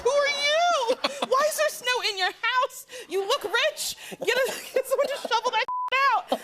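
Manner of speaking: high-pitched comic bafflement; mock outrage. Guest confronts the man who lives in snow, mock outrage. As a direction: demand answers in a high, laughing outburst. Acted baffled outburst at film character.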